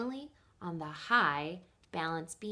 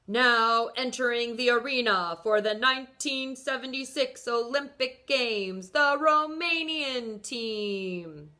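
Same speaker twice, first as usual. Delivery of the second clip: loudspeaker announcement; 3 semitones higher, 5 dB louder than usual. A narrator's reading. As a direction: boom it out like a stadium PA. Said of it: "over the loudspeaker".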